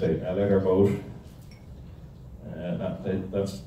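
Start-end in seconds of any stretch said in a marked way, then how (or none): none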